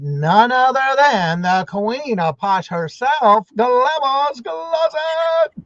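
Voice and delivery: slow build, then a high, loud drawn-out name; host hyping the queen of Posh; announcer intro. Acted. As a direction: present her like royalty arriving on stage.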